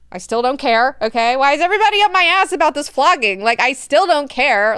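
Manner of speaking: loud, high, fed-up rant; mock exasperated rant. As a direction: vent like everyone nags you. Leto annoyed about flogging.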